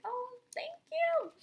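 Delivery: very high, slow, loud coo; gushing. Retold or imitated. Fan answering her idol's very happy, gushing. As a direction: squeal it out, drawn long and sweet. Retelling thrilled reply to idol.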